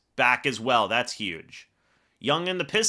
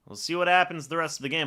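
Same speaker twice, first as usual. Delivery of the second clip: quick restatement; faster than usual. Real, not acted. Repeats faster.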